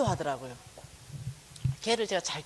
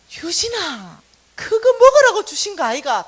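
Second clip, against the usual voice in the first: loud, higher wheedling Gyeongsang mom; coaxing mother; 9 semitones higher, 13 dB louder than usual. Retold or imitated. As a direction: coax the boy eagerly. Mother coaxing son loudly.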